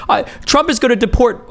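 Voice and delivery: high mock whine of fear; mock crying. Streamer mocking Hassan crying on stream. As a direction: whimper it like a scared streamer. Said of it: "crying on stream when he was like".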